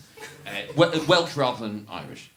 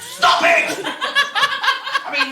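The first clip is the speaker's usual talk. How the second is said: usual speaking voice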